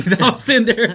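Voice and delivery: laughing through words; cracking up as you talk. Real, not acted. Laughing while telling story.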